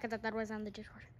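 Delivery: fast whispered aside; whispering. Real, not acted. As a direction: rush it out under your breath. Quick trailing aside.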